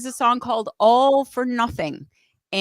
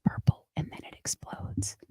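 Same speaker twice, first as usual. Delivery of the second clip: whispering; 9 dB quieter than usual. Real, not acted. Whispered aside before intro.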